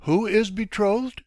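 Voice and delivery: high, slow, alarmed question; in a fright. A narrator's reading. Barber hears his sweetheart is betrothed, frightened. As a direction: gasp it out, suddenly scared. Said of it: "asks in a fright".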